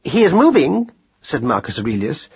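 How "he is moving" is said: high, precise pronouncement; formal observation, pitched well up; pedantic scholar mouse. A narrator's reading. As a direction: announce it like a learned little mouse. Voices Marcus Aurelius.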